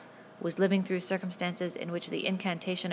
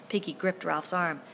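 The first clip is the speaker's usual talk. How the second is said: ordinary delivery, nothing marked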